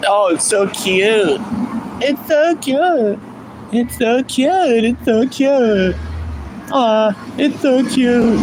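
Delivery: high, slow drawn-out "so cute"; mocking gushy woman. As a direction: stretch out the cooing mockery. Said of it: "they're like".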